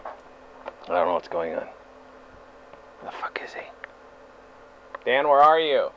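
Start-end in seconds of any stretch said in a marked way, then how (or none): 3.0-3.6 hushed, under the breath; whispering. Acted. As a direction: whisper it to yourself. Alone, searching, muttering to himself.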